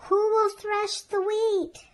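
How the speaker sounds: high, loud, slow and hopeful; hopeful call. A narrator's reading. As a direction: call out still hoping for help. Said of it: "in her very hopeful tone".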